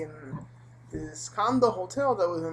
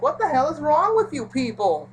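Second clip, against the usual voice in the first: exasperated outburst; 9 semitones higher, 8 dB louder than usual. Retold or imitated. Narrator outraged at both.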